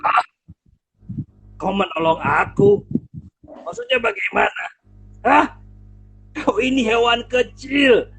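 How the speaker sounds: loud, scornful lion with a booming laugh; mocking lion. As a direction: laugh down at the tiny mouse. Singa tak percaya, menertawakan tikus. (singa mengejek tikus sambil tertawa keras)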